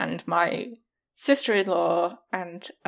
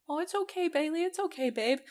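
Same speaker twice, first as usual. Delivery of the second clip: high, soft soothing coo; soothing the dog; 9 semitones higher, 6 dB quieter than usual. Retold or imitated. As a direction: comfort a hurt pup gently.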